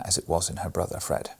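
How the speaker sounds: ordinary delivery, nothing marked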